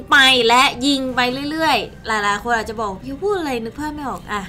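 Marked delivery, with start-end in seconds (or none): none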